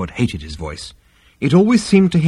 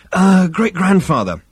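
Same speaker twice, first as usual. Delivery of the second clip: high, louder appeal; anxious interruption; 9 semitones higher, 5 dB louder than usual. A narrator's reading. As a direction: try to break into an elder's lecture. Zaphod tries to interrupt ancestor.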